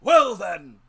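very loud, high hail; loud captain outburst. A narrator's reading. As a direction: bellow it across the yard like a sea captain. Captain bursts out.

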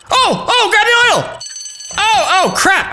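high-pitched flustered yelping; playful panic. Pretend. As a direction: cry out as if things are slipping away. Oil spurting up in game.

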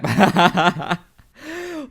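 loud laugh at his own mistake; burst of laughter. Real, not acted. Gamer laughing at dying off the map.

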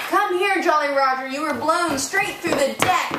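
high excited call. Acted. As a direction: call him over, thrilled. Excited calling to Roger.

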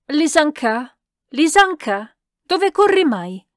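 high, loud, urgent calls; calling out. A narrator's reading. Countess calls back Lisanka as she runs off, shouting. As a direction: call someone back with impatient insistence.

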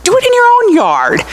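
indignant mock telling-off; retold scolding. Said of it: "I go".

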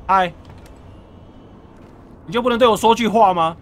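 loud, fast, high pleading shout; calling out loud. Real, not acted. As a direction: yell through the door, exasperated. Yells at android behind door. (大声喊叫)

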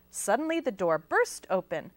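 usual speaking voice

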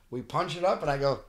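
ordinary talk

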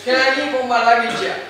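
hammed-up gushing gratitude; grateful Mugabe. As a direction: gush with thanks. Mugabe thanks Peter.

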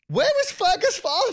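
high-pitched mock complaint; mock jealous outcry. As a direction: wail it in playful envy. Retelling own jealous reaction.

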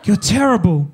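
mock collective gasp, high drawn-out "oh"; gasp, then scold in mock shock; imitated shocked audience. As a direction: imitate a crowd's scandalised reaction. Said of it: "five hundred people just went".

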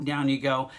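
ordinary talk, nothing marked

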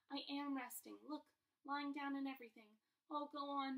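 patient begging the nurse, coaxing; pleading boy. Acted. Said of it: "Harry pleaded".

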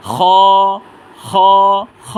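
খ heavy, held one count; thick, from throat. Shown as a demonstration. Teacher models a letter for learners, thick and throaty. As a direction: scrape খ from the throat with a full sound. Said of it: "মোটা আওয়াজে উচ্চারণ করব".

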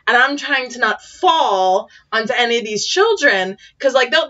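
ordinary delivery

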